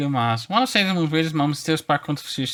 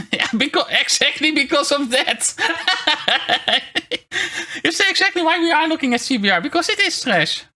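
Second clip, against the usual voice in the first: high, giggly laughing talk; cute anime streamer; 13 semitones higher, 5 dB louder than usual. Real, not acted. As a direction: laugh through the words, pitched way up. Laughing while mocking a show.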